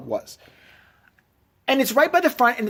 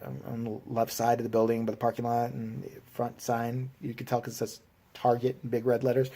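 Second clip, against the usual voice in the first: meek mumble; 7 semitones lower, 10 dB quieter than usual. Retold or imitated. Meek reply to cop on phone.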